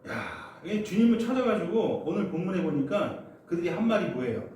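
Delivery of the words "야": ordinary talk, nothing marked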